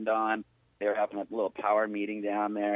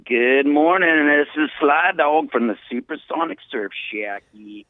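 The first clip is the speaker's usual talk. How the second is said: big radio intro; 3 semitones higher, 9 dB louder than usual. Acted. Surf reporter greeting listeners with a booming on-air intro. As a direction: announce yourself like a DJ.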